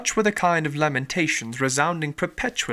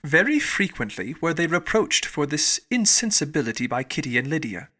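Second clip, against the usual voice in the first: ordinary delivery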